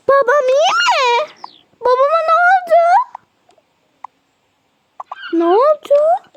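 high, anxious questioning; worried child. Pretend. Child asking whether father is all right, worried. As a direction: plead for news in a high voice.

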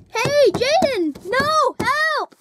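high, panicked cries from inside a closet; calling for help. Acted. Trapped kid calling to Jaden for help, panicked and high. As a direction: yell out to get freed.

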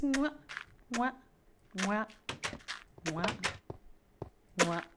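playful smooch noises; spaced-out "mwah" kisses; kissing sounds. Pretend. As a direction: blow kisses one at a time.

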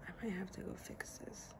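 real hushed whisper, hiding from her. Real, not acted. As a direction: whisper so she can't hear. Sneaking while partner works nearby.

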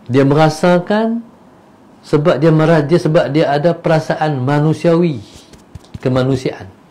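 ordinary talk, nothing marked

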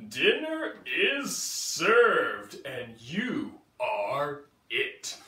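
loud, slow monster voice; menacing T-Rex. Acted. T-Rex telling Stanley he is dinner, slow and menacing. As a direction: savor every word, hungry. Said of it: "said the T-Rex".

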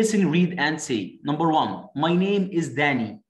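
slow, clear textbook English; very slow dictation pace; slow model reading. Shown as a demonstration. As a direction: read each word out slowly for learners. Reading textbook dialogue aloud.

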